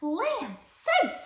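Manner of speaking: high, startled mama bear. A narrator's reading. Mama bear sees the sleeper, exclaiming high. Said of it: "said Mama Bear".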